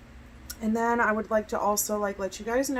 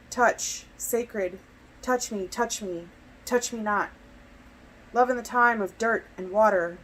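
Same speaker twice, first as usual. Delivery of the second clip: deliberate spoken-word delivery, louder than chat; poetry reading; 5 dB louder than usual. Acted. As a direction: recite in short phrases with pauses between. Reads own poem aloud.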